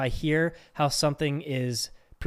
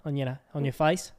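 halting put-on Aussie accent; amateur Australian, feeling for the vowels; broad mock Australian question. Shown as a demonstration. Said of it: "if I'm doing Australian".

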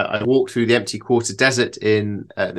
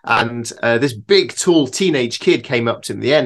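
ordinary talk

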